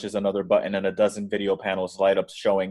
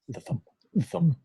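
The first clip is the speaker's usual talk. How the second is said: heartbeat thump; 9 semitones higher than usual. Acted. Heartbeat drum sound.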